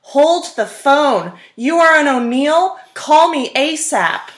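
loud, slow, high mock shout; booming mock yell of a coach summoning her; all-caps shouting email. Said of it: "I got an email in all caps that said".

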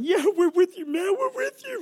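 very high, excited chorus; eager crowd reply. Retold or imitated. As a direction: mimic the congregation gushing agreement. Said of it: "And everybody's going".

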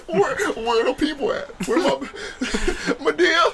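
high, laughing mock call; hammed-up search for your own people. Teller left without a partner, mock searching call. Acts out looking for his people.

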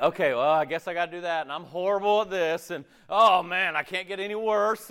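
high-pitched, put-on whining; pouting whine. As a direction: whine it like a sulky complainer. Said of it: "we're pouting and we're whining".

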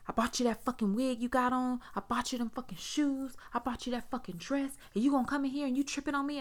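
high, heated rant; angry drunk rant. Retold or imitated. Drunk husband cussing her out.

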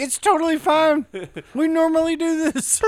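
breezy high-pitched fake calm; way up high, play it casual; high mock casual voice. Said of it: "Dude's like".

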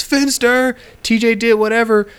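very high tattletale call; mimicked tattling. Snitch tells Finster on T J, mimicked high tattling. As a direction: call out high like a kid telling on someone. Retold kid telling on T J.

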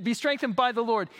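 ordinary talk

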